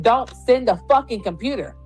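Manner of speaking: normal talking voice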